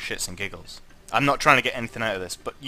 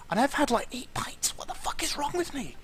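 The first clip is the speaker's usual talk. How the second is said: disbelieving squeak; 13 semitones higher, 6 dB quieter than usual. Real, not acted. Squeaky disbelief after landing.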